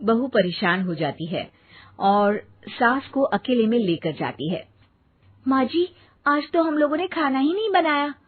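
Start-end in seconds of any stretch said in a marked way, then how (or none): 0.0-4.7 lower, even storyteller voice; story narration. A narrator's reading. Narrator telling the audience what happens, in a calm storytelling voice. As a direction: narrate the scene calmly.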